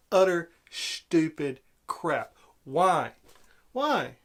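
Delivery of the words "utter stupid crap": "Utter stupid crap" spelled out slowly, every word hit; slow disgust. Real, not acted. Ranting verdict on the scene.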